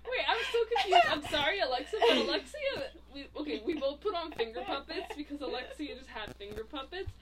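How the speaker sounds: confessing the puppet mishap, laughing hard; laughing through it. Real, not acted. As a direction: tell it while barely holding in laughter. Laughing confession about finger puppets.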